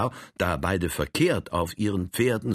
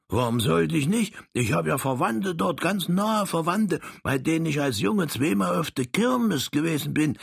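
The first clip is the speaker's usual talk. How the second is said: a touch higher, curious; lightly raised Frank voice; 9 semitones higher than usual. Acted. Frank asks the little Saxon, lightly higher voice.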